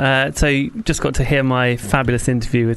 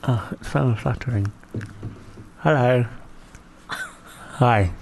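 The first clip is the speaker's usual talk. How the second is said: talking through a mouth guard; mouth held open; 2 semitones lower, 6 dB quieter, slower than usual. Real, not acted. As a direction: lips kept apart, can't close. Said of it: "it basically keeps my mouth open".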